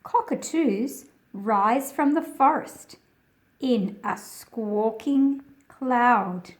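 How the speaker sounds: projected read-aloud narration; reading to children. A narrator's reading. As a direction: read the picture book out to a class of kids. Reads picture book aloud.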